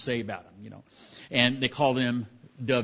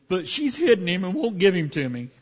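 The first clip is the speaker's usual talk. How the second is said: higher, louder tearful plea, imitated; crying; 7 semitones higher, 6 dB louder than usual. Mother complains to the king about her hidden son, imitated tearful plea. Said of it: "she starts crying and says".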